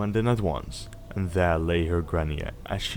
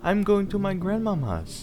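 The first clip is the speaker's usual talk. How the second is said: put-on much higher little girl voice; 12 semitones higher than usual. Girl tells the wolf where she's going, high child voice. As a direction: chirp it like a small child. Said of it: "said the child".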